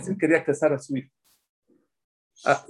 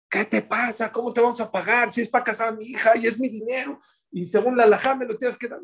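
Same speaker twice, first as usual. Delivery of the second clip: much higher, indignant father's objection; indignant protest; 8 semitones higher than usual. Acted. Father refuses to pay the finder, indignant and high. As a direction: push back in disbelief, pitched high.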